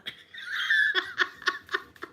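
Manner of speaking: breathy high burst of laughter; airy giggle. Real, not acted. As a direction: laugh out loud at the joke. Laughing at own joke.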